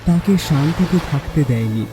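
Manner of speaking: ordinary delivery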